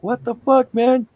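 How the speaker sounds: very high mock protest; imitated outraged teammates. Dad imitating teammates his son just shot, outraged. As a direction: squeal a complaint as the betrayed player.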